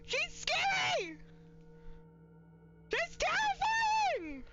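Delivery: high, slow, drawn-out shriek; panicked wail. Real, not acted. Streamer shrieks at the creepy doll character. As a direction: wail it in terror.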